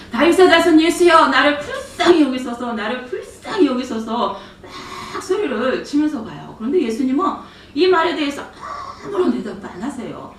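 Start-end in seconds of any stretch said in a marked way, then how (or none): 0.1-4.4 loud pleading cry for mercy. Retold or imitated. A mother shouting after Jesus, begging for mercy, loud cry. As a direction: call out desperately to Jesus from behind. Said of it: "뒤에서 막 소리를 지르는 거예요".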